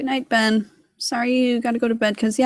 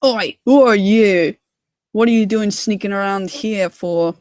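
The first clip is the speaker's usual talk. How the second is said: slow, loud challenge to an intruder; Sailor John; 3 dB louder than usual. Acted. Sailor John confronting Thomas in the lamplight, suspicious challenge. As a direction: gruffly demand an answer, drawn out. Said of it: "I have Sailor John with the lamp".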